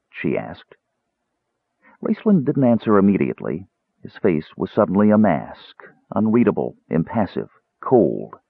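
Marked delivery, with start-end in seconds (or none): none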